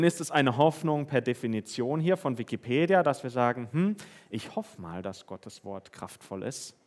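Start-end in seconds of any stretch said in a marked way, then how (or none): 3.7-6.7 half-hearted hope. Pretend. Mock doubtful hope.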